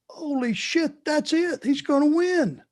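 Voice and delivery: high excited crowd reaction; crowd going wild. Retold or imitated. Audience sure he will win, excited high shout. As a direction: call it like a stunned crowd that believes. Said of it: "the audience went".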